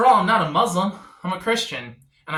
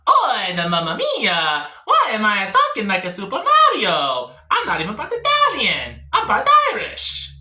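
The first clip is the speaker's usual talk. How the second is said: over-the-top Italian Mario voice, higher, breaking toward Irish; Italian accent; 3 semitones higher, 4 dB louder than usual. Pretend. Showing his friend how Mario should really sound, hammy Italian accent. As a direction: ham up an Italian accent, laughing through it. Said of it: "You gotta do it more like this".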